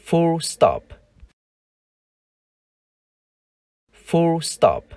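full stop, stress on full, falling; English full stop. Shown as a demonstration. Teacher modeling full stop for learners. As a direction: say full stop slowly and clearly. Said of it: "chúng ta đọc là full stop".

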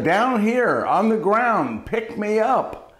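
high, slow frog voice; drawn-out, high-pitched little plea; talking frog calling. Acted. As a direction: call up slowly from the ground. Frog calls up from the ground.

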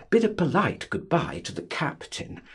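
usual speaking voice

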